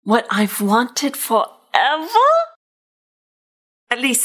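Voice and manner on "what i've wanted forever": plain reading voice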